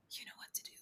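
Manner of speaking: soft unvoiced whisper. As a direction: breathe it like a secret at the back of the head. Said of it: "my intuition sounds like a whisper".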